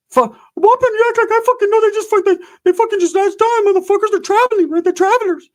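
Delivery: very high, stammering cartoon falsetto; worked-up mouse-voiced cousin; squeaky flustered cousin. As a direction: squeal out a frantic excuse. Cousin squeaks out roach excuse.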